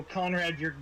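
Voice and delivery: ordinary talk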